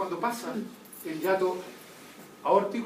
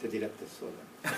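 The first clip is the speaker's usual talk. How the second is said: under-breath aside; 11 semitones lower, 9 dB quieter, slower than usual. Real, not acted. Teacher's aside on student's guess.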